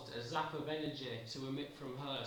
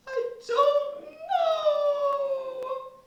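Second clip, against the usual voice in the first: very high, loud, stretched-out howl of I don't know; hammed-up shrieking wail; wailing cry; 17 dB louder, much slower than usual. Fritz wailing his answer.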